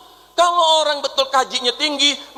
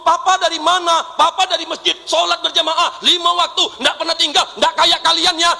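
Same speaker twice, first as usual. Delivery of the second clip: higher, louder voiced child and boastful father; mock family quarrel; 7 semitones higher, 5 dB louder than usual. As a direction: act out child asking, father bragging.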